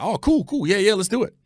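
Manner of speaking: high-pitched, eager yeses; quick retold burst of keenness; retold eager agreement. Agreeing on the phone to a buddy's find, eagerly.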